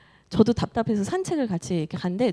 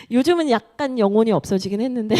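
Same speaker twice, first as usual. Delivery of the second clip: usual speaking voice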